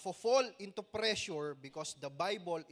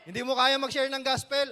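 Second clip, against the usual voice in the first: teasing call out; 5 semitones higher, 11 dB louder than usual. Pretend. Mock challenge in joke.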